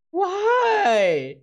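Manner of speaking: normal talking voice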